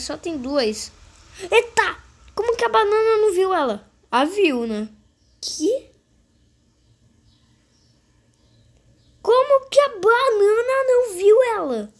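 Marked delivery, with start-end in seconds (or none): 1.4-3.9 high, fast astonished outburst; surprised. Real, not acted. Surprised the banana missed her. 5.4-11.9 high, loud disbelieving cry; incredulous. Real, not acted. Disbelief, repeats question.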